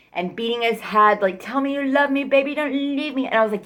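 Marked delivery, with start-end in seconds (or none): 1.4-3.3 high, begging mock-whine; pleading boyfriend voice. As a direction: plead like he can't let go. Imitating boyfriend pleading in car.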